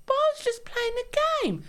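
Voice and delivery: high-pitched mocking whine; exaggerated squeaky self-defence; whiny husband imitation. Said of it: "I don't speak like that".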